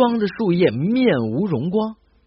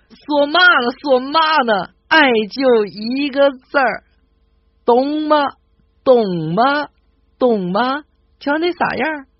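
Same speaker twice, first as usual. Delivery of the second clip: put-on higher, louder brash Tianjin accent; bold Tianjin girl; 7 semitones higher, 4 dB louder than usual. A Tianjin girl slaps the table answering her suitor, higher and louder in a Tianjin accent. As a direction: slap the table and set him straight. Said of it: "大大方方的一拍桌子说".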